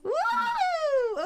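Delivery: high, drawn-out "woo" of applause; excited whoop. Real, not acted. Host cheering a guest's impromptu song, excited high whoop.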